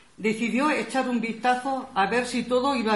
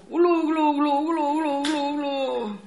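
higher gurgling sinking sounds; 4 semitones higher, much slower than usual. Acted. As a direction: gurgle as if drowning, fading. El lobo se ahoga. (gorgoteo de ahogado)

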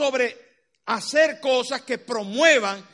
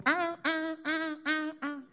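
higher, soft panicky yelps; mock panic; 7 semitones higher, 8 dB quieter, slower than usual. Fair-weather believers flee in mock panic from conflict. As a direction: play the scared runaway, lightly.

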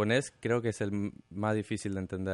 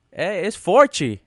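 put-on slow, high, loud Portuguese-accented forty; Brazilian student's forty; 7 semitones higher, 9 dB louder, much slower than usual.

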